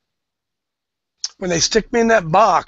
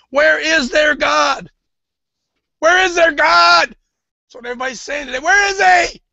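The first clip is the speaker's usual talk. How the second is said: rising, preacher's scripture reading building to a shout; fiery preaching; 6 semitones higher than usual. Acted. Preacher reading Joel to his congregation, building to a shout. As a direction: build the reading until it breaks into a cry.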